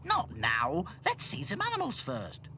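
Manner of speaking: loud start, rising high; Ethel refusing. Acted. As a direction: put the boys off firmly. Ethel puts boys off.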